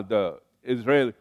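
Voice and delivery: ordinary delivery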